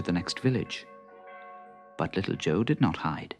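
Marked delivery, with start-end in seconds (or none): none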